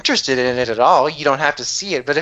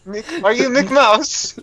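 higher and slow, joking; goofy slow voice; 8 semitones higher, much slower than usual. Pretend. Teasing a friend about McMouse, slow goofy voice. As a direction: drawl it out, silly and playful. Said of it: "joking riff".

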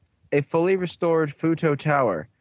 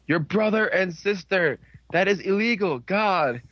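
higher, emphatic protest; mock scandalized outrage; 5 semitones higher than usual. Viewer objecting to brother and sister, mock outrage. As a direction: protest as if utterly appalled.

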